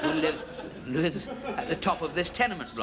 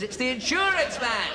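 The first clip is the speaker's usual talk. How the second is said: slow, high, louder mock yell; frustrated shouting; 11 semitones higher, 5 dB louder than usual. Insurance man repeats himself to the parrot, slow high exasperated shout. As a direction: drag it out louder through the door. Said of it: "he shouts through the letterbox".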